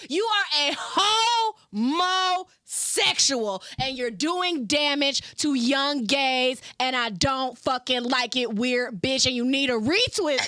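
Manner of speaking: loud, slow, cutting rant; heated rant. Real, not acted. Addressing [name] directly, loud heated scolding. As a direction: let it rip at her.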